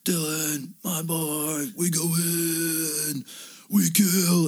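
high, slow, breathy-sounding dying plea; a lightly voiced hurt soldier crying out. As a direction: soldier hit by the boomerang, mock pained cry. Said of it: "And he's like".

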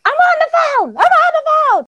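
imitated mom's shrieking high, loud scold. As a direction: yell at noisy kids in a very high pitch. Mom scolds kids, hand over phone.

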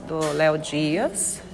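usual speaking voice

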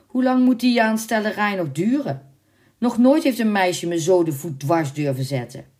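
exasperated. A narrator's reading. Knight to the girl who resists him, exasperated. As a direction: complain in indignant exasperation.

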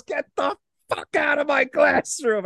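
shrill teacher yell. Retold or imitated. Teacher throwing the narrator out of class, yelling high. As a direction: throw him out like an enraged teacher.

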